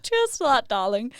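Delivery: lightly posh British toast, darling lilted; a hint of a posh British granny raising a glass. Granddaughter voicing posh British granny in her ad. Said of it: "And she's like".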